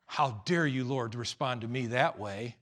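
ordinary delivery

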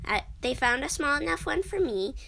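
usual speaking voice

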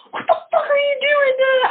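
high shocked disbelief; shocked outburst. Retold or imitated. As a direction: blurt it out in horror, pitch way up. Retelling her shocked reaction.

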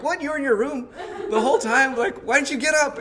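high, loud, incredulous complaint; mock outrage. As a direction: act out exasperation at a brother. Acted outrage at sleeping brother.

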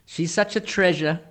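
high, gushing false praise; mock-sweet compliment. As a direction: praise her with hidden venom. Mock Southern backhanded compliment.

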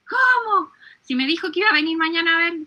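sudden high "¿Cómo?" then a fast rush; a retold woman's startled outburst to the priest; astonished. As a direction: jump up in pitch in disbelief, then tumble on quickly. Woman astonished at Padre Pío's news.

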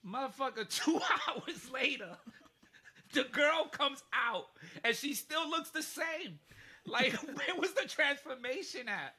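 high, loud, laughing disbelief; cracking up in outrage over the wait; exasperated outburst. Retold or imitated. Telling a friend about the endless Disney store, laughing outrage.

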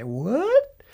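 WHAT sliding steeply up in pitch; WHAT as a high rising question; upspeak. Shown as a demonstration. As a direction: say WHAT with a big questioning rise at the end. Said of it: "And your voice goes up".

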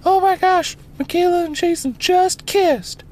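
put-on very high squealing girl; shocked girl voice. Annie telling the guy on the phone, high squeal. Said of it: "And she's like".

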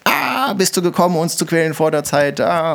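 half-hearted spirit shriek; demon crying out. Acted. Unclean spirit crying at Jesus, a mild mock cry. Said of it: "der Geist schreit".

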